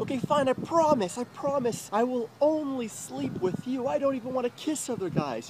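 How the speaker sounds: high, pleading imitation of her; mocking crying girlfriend. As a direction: parody her begging in a high voice. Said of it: "She's like".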